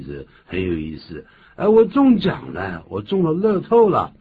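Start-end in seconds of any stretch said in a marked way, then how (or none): 1.6-4.1 high, loud acted jubilation; thrilled exclamation; excited winner. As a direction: announce a big win, pitch way up. Acting a lottery winner's announcement.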